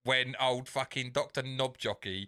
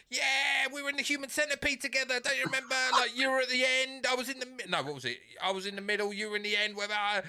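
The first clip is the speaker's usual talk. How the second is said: much higher, fast, chummy voice; Peele character; 11 semitones higher, faster than usual. Retold or imitated. Retelling the sketch, Peele's overeager character in a high voice. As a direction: play the overeager old castmate. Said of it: "He goes".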